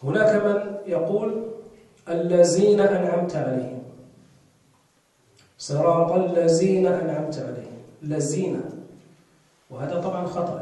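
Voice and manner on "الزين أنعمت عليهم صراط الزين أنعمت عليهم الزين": "الذين" said with a z, "allazina"; dhal as zay. Shown as a demonstration. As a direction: recite الذين with a buzzing z. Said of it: "وليست الزاي".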